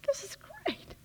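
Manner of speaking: very high, soft, breaking squeal of joy; squeaky delight. Retold or imitated. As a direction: squeak it out, overjoyed.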